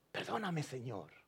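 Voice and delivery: soft, humble asking for pardon; a quiet confession to God; hushed prayer. Acted. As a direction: let it fall to almost a murmur. Voiced prayer.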